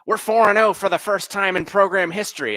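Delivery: high-pitched, cocky fan impression; mock nerd voice. Complete nerd chiming in on a conversation, mock nerd voice. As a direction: imitate a nerd proudly chiming in, pitched well up. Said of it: "He keeps chiming in".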